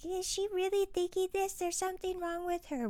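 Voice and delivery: a high, prissy voice feigning alarm at her thoughts; shocked onlooker. Retold or imitated. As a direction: play the pearl-clutching critic in a high, shocked voice. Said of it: "So for anybody that goes".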